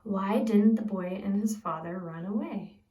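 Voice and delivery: ordinary delivery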